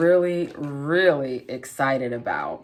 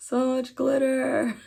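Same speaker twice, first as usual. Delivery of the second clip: laughing; 9 semitones higher, slower than usual. Real, not acted. Laughing at glitter mess.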